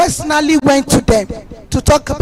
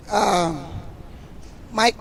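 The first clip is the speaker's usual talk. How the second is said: ordinary delivery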